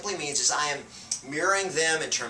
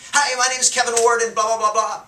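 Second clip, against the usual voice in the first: much higher and louder, over the top; overpowering greeting; 9 semitones higher, 9 dB louder than usual. Shown as a demonstration. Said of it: "I'm gonna blow 'em away".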